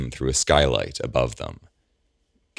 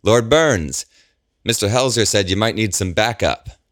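loud, higher officer's call across the street; yelling; 8 semitones higher, 5 dB louder than usual. A narrator's reading. Officer yelling to Gabriel across the street, loud and high. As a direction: call out over the noise to the lord. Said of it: "yells at them".

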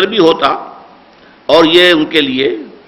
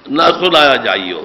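normal talking voice

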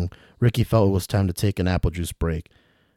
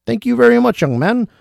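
put-on dangling old traveler; 9 semitones higher, 5 dB louder than usual. William calling to Ricky from upside down, high and fussy. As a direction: call down politely from the rope.

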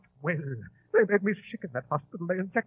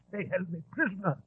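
normal talking voice

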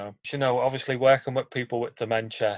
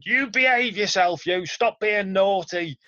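high, loud telling-off called up to a balcony; imitated scolding shout; 8 semitones higher, 4 dB louder than usual. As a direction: act out a wife shouting up at her husband. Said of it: "his wife shouting up at him".

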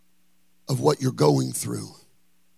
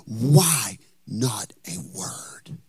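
whispered prayer; 5 semitones lower, much slower than usual. Acted. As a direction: whisper it to God.

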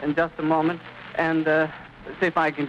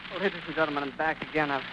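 shaken and quiet; 7 dB quieter, faster than usual. Real, not acted. Returning after breaking down.

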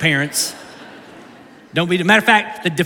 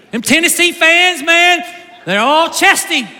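higher, louder playful jeer at rival fans; teasing shout; 7 semitones higher, 5 dB louder than usual. Real, not acted. Ribbing a Tennessee fan in the room, playful loud jeer. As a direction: call them out loud and grinning.

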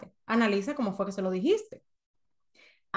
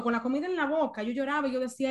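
usual speaking voice

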